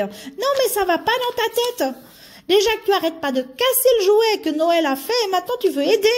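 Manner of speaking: put-on high girl elf voice, exasperated and fast. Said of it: "faire la voix de fille".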